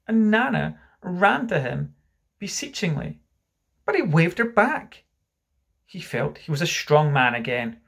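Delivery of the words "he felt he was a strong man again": plain reading voice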